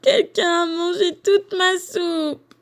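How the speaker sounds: put-on high, slow wailing baby bear; drawn-out mock sobbing, pitched high; crying little bear. A narrator's reading. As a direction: wail it out like a crying cub. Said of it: "il se met à pleurer".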